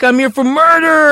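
loud, high-pitched boast; retold inmate holler. Inmate telling newcomer his charge, imitated high holler.

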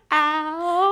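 exaggerated wounded yelp; mock cry of pain. Roommate being hit by drunk friend, mock pained. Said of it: "I was like".

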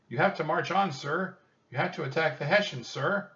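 high, loud, insistent urging; urgent shouting. A narrator's reading. Annie urges George Washington to attack, shouting. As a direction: shout orders like a pleading girl. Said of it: "she shouted".

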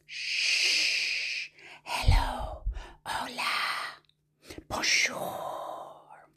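hushed whisper; gentle whispered greetings; very soft. Shown as a demonstration. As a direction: whisper it as softly as you can. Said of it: "as softly as we can".

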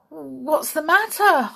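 Molly asking in a fright; scared. A narrator's reading. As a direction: ask in alarm, voice jumping up.

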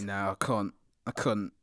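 low, soft put-on British accent. Shown as a demonstration. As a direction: demur modestly in a British accent. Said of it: "start the British accent".

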